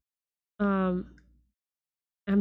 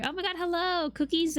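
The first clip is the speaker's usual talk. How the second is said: excited greeting; 8 semitones higher than usual. Real, not acted. Greeting a viewer in chat.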